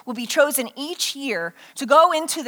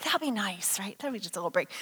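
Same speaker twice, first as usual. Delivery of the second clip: low, soft aside; hushed, wistful little confession; confiding wish; 6 semitones lower, 12 dB quieter, faster than usual. Acted. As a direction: murmur it like a secret admission. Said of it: "you're like".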